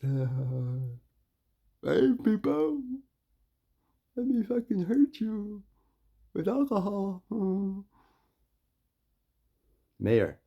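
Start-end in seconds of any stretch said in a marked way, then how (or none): 0.0-7.8 much higher, louder mocking voice, slow on hey people; hammed-up sleazy pusher calling out to customers; mocking liquor store guy. Mocking the liquor store man luring people in, high and loud.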